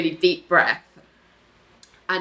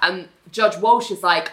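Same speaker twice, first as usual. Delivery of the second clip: ordinary talk, nothing marked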